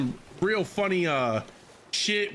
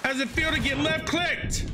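taunting; 11 semitones higher than usual. Real, not acted. Taunting another player in game.

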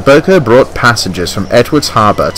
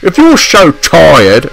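slow, biting and higher; snapping; 6 semitones higher, 4 dB louder, slower than usual. Acted. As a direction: bite the words off in anger. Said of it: "snapped Boko".

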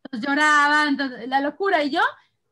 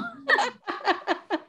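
quiet breathy laugh; soft giggle at own joke; 9 semitones higher, 5 dB quieter than usual. Real, not acted. Laughing at her threat to eat the cookies, quiet laugh.

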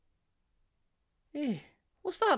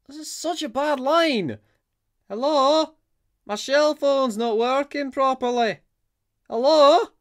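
loud, high calls of hello over a crackling phone; loud raised hellos into a phone; calling out on a bad line; 7 semitones higher, 7 dB louder than usual. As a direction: shout to be heard down a bad line.

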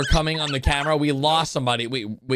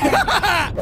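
short laugh; 10 semitones higher than usual. Real, not acted. Laughs at Yellow.

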